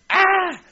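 high, loud mock shriek; mock scream. Preacher mocking the aliens idea to his audience, mock scream. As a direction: let out a short, loud "ah!" of disbelief. Mimics reaction to aliens claim.

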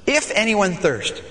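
ordinary talk, nothing marked

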